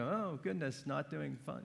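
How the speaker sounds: soft, under-the-breath mock grumble; muttered dismay. Voices the audience's reluctant reaction.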